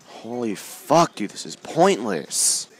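high, loud, slow drawn-out outburst; raging yell. Real, not acted. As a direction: stretch it out in real gamer fury. Gamer raging at losing.

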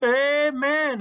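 high, loud exultant cry; joyful shout. A narrator's reading. Boy celebrating as if in church, joyous shout.

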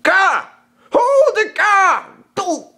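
high, loud startled yell; yelping shout. Acted. Kaa crying out as he is interrupted, high startled shout. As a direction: shriek it out in protest. Kaa yelps at being stopped.

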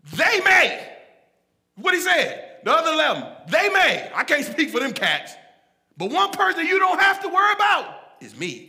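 high, forceful acted protest; loud boasting. Peter swearing to Jesus he won't fail, loud boasting. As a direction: insist on your loyalty, loud and high.

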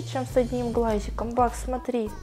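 usual speaking voice

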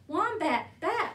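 high, loud cry for Wombat; urgent call. A narrator's reading. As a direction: call out in alarm to be let in. Sugar Glider calls out for Wombat.

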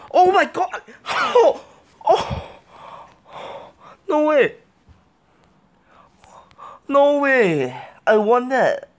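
very high, loud shrieks of amazement; real overjoyed shock, words stretching out by the end; ecstatic disbelief. Real, not acted. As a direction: scream in stunned joy at a clutch win. Unexpected clutch win.

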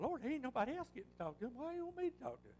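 fast, rising, half-laughing objection; incredulous protest. Retold or imitated. Preacher protests to the Lord, fast and incredulous. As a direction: push back at the Lord in disbelief.

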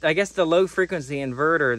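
normal talking voice

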